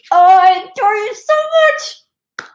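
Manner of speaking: high, loud, drawn-out gush; gushing adoration. Pretend. Host play-acting smothering viewers with kisses, gushing adoration. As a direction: squeal it slowly in a put-on voice. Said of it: "That's me".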